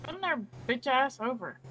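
normal talking voice

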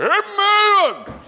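a high, loud hollered amen; camp-meeting amen, hollered; shouted amen. Retold or imitated. Old boy's shouted amen retold.

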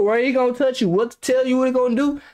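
normal talking voice

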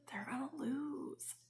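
very soft, slow whisper; hushed, drawn-out whisper; whispered thought. Retold or imitated. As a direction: whisper it slowly, like a secret you dread telling. Secret knowing replayed in her head.

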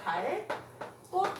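갈 꽃 said as 갈 꼳; tense, unaspirated ㄲ starting 꽃 in 갈 꽃; tensed pronunciation. Shown as a demonstration. Teacher shows how it is read, tensed 갈 꽃. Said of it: "얘는 어떻게 읽는다는".

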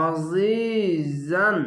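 আজিজান very slow, the জি stretched; Quranic recitation demo. Shown as a demonstration. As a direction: stretch the জি in আজিজান, throat-pressed আইন. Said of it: "এক আলিফ টেনে পড়বেন". (টেনে আজিজান তিলাওয়াত)

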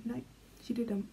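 usual speaking voice

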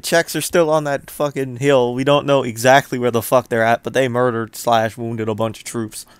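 usual speaking voice

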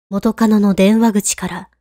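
plain reading voice

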